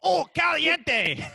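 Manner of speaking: high, loud hammed-up Mexican exclamation; mock Mexican accent. Podcaster prompting his friend's food-fusion voice, mock Mexican accent. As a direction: draw it out in a cartoon Mexican accent. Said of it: "What would that sound like, Dalton?".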